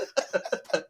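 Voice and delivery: high, slow, laughing words; cracking up. Real, not acted. Recalling the bank skit to the co-host, cracking up. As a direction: squeal it through helpless laughter.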